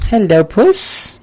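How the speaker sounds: high, loud and very slow pet-greeting coo; cooing to cat. Real, not acted. Streamer greeting his cat as it jumps up, high and slow coo. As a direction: stretch it out, cooing over the cat.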